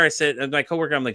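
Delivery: ordinary talk, nothing marked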